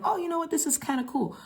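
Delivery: high, airy mock delight at "Oh"; pleasantly surprised. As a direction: act like you just found something cool. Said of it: "And I'm like".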